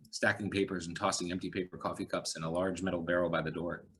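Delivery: usual speaking voice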